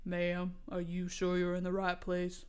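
half-asleep teacher asking, puzzled; sleepy confused man. A narrator's reading. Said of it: "he asked me, confused".

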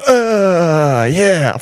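long mock-ecstatic moan; shareholders moaning. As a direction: moan it out like a swooning crowd. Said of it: "and they're all like".

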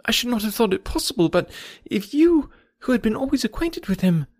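high-pitched, flustered outpouring; upset young friend explaining herself; agitated. A narrator's reading. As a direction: protest in a hurt, lifted voice. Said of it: "in a voice nearly as agitated as Emma's".

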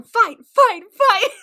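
loud, high chant; egging on a fight. Retold or imitated. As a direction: chant it like a crowd round a brawl. Acting out cheering on a fight.

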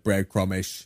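Cockney "Breadcrumish"; Cockney accent. Shown as a demonstration. Showing a friend how the name sounds in Cockney. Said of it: "like, a Cockney accent".

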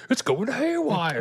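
high-pitched cry of alarm; off-screen panicked bystander. Retold or imitated. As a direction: call it out high, imitating someone off screen. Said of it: "off screen is like".

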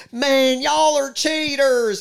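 high, slow mocking Southern drawl imitating a Titans fan. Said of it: "they would say".

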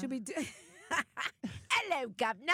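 a lightly put-on British accent; chirpy British greeting, tongue in cheek. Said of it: "I'm still working on my accents".

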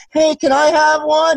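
a hint of an eager young boy asking for a beer; kid camper voice. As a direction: ask sweetly like a young camper. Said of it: "He goes".

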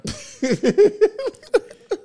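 loud high burst of laughter; big laugh. Real, not acted. Storyteller bursting into loud laughter. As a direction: crack up.

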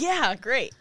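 put-on breezy reply; retold guests' reply. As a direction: imitate the guests agreeing loudly. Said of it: "And they're like".

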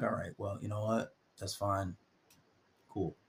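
low, soft, unbothered reply; calm. Retold or imitated. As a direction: let it go coolly and quietly. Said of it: "because you're calm".

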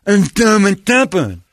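put-on high, loud, slow kid voice; hammed-up deaf kid; deaf kid impression. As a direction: mimic a half-deaf boy. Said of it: "That's a really good impression".

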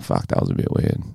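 whispered, embarrassed aside; sheepish whisper. Retold or imitated. Imagined partner whispers after the act, sheepish. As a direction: hush it like you regret it. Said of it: "You're like".

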